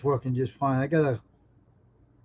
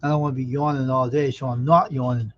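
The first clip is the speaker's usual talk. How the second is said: normal talking voice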